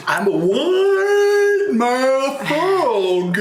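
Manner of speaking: high, hugely drawn-out frog boast; slow, hammed-up big-mouth frog; wide-mouth frog. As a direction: open wide and stretch the vowels way out, pitched high. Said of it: "He goes".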